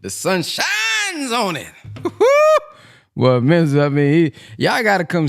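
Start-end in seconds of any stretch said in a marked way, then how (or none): 0.0-1.7 mock preacher, high, slow and laughing; imitating the minister. Host copying the minister's big sermon line, laughing. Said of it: "if we master the way the minister emphasized them words". 1.8-2.6 high burst of laughter. Real, not acted. Cracking up at own imitation.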